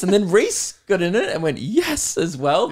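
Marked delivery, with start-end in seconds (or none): 1.6-2.2 high-pitched mock delight; imitated gleeful yes. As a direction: copy a friend's high, delighted cry. Imitates Reese joining the fun.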